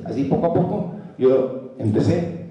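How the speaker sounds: ordinary talk, nothing marked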